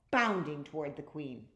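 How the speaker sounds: plain reading voice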